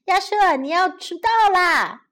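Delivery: 妈妈大声喊; mother shouting. Acted. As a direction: shout up the stairs to wake him. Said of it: "妈妈从楼下大声喊道".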